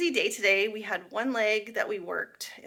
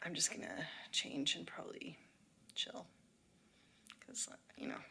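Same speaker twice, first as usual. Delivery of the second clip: whisper; 5 semitones lower, 14 dB quieter than usual. Real, not acted.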